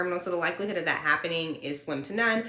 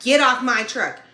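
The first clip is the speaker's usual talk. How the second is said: louder, higher command; barked order; 5 semitones higher, 7 dB louder than usual. Retold or imitated. Trainer ordering her off the truck, louder. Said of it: "and was like".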